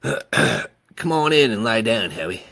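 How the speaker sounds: plain reading voice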